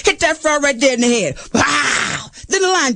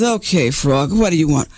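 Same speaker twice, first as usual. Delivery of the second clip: lower, gruff fox voice; 9 semitones lower than usual. Acted. Fox answers the frog at his cave, lower and gruff. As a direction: speak down as the boss fox.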